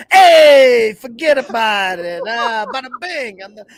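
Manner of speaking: hammed-up Italian-American mobster, loud opening; mobster Pitino. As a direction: brush it off like a cartoon wiseguy. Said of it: "imagine if that question would have been asked differently".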